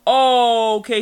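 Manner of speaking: lightly mock quippy hero. Spider-Man spots something and cracks a joke, quippy. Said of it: "he's making a quippy joke".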